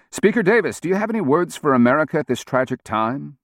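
bright, high TV reporter voice; news reporter. A narrator's reading. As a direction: ask it like a live TV anchor. Reporter voice in a story.

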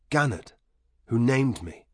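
ordinary delivery

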